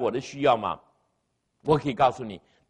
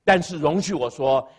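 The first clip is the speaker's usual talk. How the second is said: usual speaking voice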